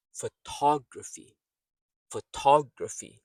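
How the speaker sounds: "Photography" with a loud, high "tog"; fuh-TOG-ruh-fee, punch the second syllable of "Photography"; model for shadowing. Shown as a demonstration. Teacher has learners speak along, "Photography" with strong second syllable. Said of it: "So speak along, mimic, shadow".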